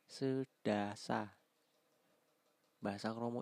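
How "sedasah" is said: slow repeat. Shown as a demonstration. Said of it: "repeating word slowly".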